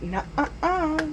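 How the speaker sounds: drawn-out, high-pitched teasing no; teasing refusal. Retold or imitated. Grinch stopping two eager boys, teasing sing-out. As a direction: wag a finger with the voice, high and slow.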